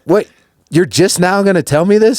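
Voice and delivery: high, shocked demand; shocked disbelief. Retold or imitated. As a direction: blurt it out in disbelief. Reacting to late news of accident.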